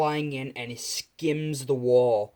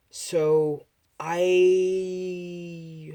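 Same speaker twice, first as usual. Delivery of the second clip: higher, louder, long drawn-out I; awkward stalling; 6 semitones higher, 5 dB louder than usual. Acted. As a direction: stall before breaking awkward news. Father stalls before awkward news.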